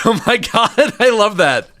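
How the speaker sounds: high, loud laughing delight; thrilled and laughing. Real, not acted. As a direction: squeal it with real glee.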